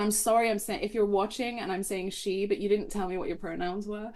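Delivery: ordinary delivery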